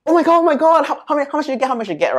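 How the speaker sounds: high, excited rush of questions; eager classmate. Retold or imitated. Classmate pestering the speaker for her exam score, high and excited. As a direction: pester for the score, all worked up. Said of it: "my classmate will be like".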